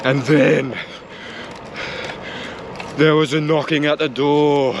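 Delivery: hammed-up spooky storyteller; playful suspenseful storytelling voice; campfire tale. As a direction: tell it like a mock ghost story round the fire. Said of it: "Feels like campfire tales or something".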